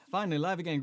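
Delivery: usual speaking voice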